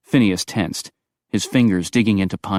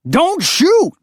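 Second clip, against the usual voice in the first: panicked shout; 6 dB louder than usual. Acted. Bear-man surrenders at gunpoint.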